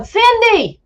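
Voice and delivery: high, loud mock call of the name; imitated call. Wife retelling a voice calling Cindy, mock holler. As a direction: echo a distant holler of the name. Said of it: "they were going".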